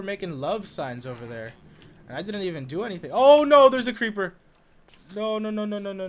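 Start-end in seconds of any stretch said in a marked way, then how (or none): 3.1-6.1 loud, high cry of alarm. Real, not acted. Gamer suddenly spotting a creeper, alarmed. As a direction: burst out in sudden panic, then ease off.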